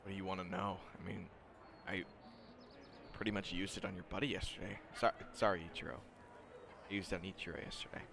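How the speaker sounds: quiet, hesitant student; soft and quick, stumbling into an apology; Shinso. Acted. Student owning up about his quirk, softly and hesitantly. Game master voices Shinso.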